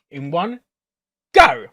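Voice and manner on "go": loud go. Real, not acted. Ending her countdown to the viewers, a loud shout. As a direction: shout the start, high and loud.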